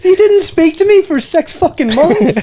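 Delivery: high, drawn-out mock protest; hammed-up exasperated squeal; indignant disbelief. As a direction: protest it way up in pitch, slowly. Protesting her claim, amid laughter.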